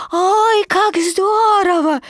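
high squeaky little voice, thrilled; Baby Roo, delighted. A narrator's reading. Baby Roo after bouncing in the shirt, delighted squeak.

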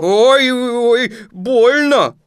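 high, loud yowl of pain; pained cry. Acted. Pooh tugged by the paw, crying in pain. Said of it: "пока Винни не закричал".

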